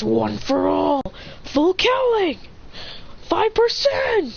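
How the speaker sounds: anime move names climbing high; high, stretched heroic announcement; power-up call. Acted. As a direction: build to a drawn-out battle call. Acted power-up calls in story.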